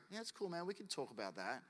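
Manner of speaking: soft, laid-back acted reply; easygoing reassurance. God answering a struggling believer, soft easygoing reassurance. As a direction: play it cool and quiet.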